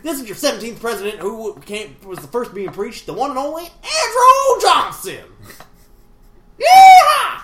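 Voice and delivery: hillbilly announcer building to a loud, high whoop. Acted. Host signing off as seventeenth president, hillbilly accent building to shout. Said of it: "part hillbilly".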